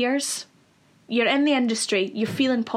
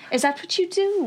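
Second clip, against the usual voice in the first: high put-on Welsh lilt; lilting Welsh mimicry; mock Welsh sing-song; 8 semitones higher than usual. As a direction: ask it high, in an imitated Welsh accent. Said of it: "that up and down sing-song".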